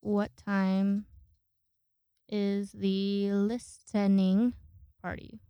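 reading a text aloud, slowly; slow dictation. Shown as a demonstration. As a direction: spell the message out word by word. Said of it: "gonna tell you guys what I'm texting".

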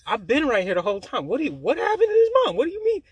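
rising to a high, loud, incredulous squeak; can't believe what she just said; baffled. Retold or imitated. To his friend, retelling the fake voicemail: high incredulous disbelief.

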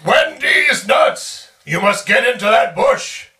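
loud slow call across the room. Acted. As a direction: shout it out, drawn out. Calling out to party member.

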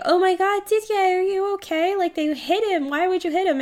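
high-pitched mock concern; high, fretful retold reaction; retold alarm. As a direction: imitate people freaking out, quick and high. Everybody freaks out in retelling.

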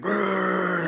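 high straining grunt; imitating his lifting grunt; high strained grunt. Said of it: "I was just like".

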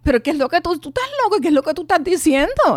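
grito agudo y fuerte, incrédula; grito de negación. Retold or imitated. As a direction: gritarle que está loco. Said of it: "le di puños así en el pecho y digo". (grito agudo de negación)

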